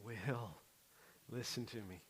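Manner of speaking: soft, hushed gentle calling; calm someone gently by name; Jesus softly soothing a frustrated believer; tender. Retold or imitated. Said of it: "he gets it and he goes".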